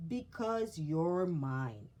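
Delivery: slow, tender declaration; a father telling his child he belongs; spoken very slowly. Acted. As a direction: let each word land gently. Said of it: "spoke very slowly".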